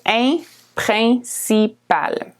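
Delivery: In, principal with nasal in sound; nasal vowel. Shown as a demonstration. Teacher showing the nasal In, French pronunciation. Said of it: "The I N is a nasal vowel".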